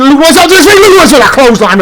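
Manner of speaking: rising hype as he gets up; energized ringside call; excited call. Acted. Commentator calls a comeback.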